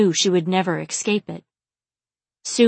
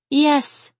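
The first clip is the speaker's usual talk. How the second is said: high, needy whimper; 8 semitones higher, 4 dB louder than usual. A narrator's reading. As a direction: whimper it at a raised pitch. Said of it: "Chai whimpered".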